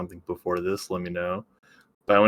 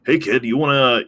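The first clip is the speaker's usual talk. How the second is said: lightly acted breezy offer, higher and louder; mock casual Picard; 6 semitones higher, 5 dB louder than usual. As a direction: toss out a playful, casual invitation.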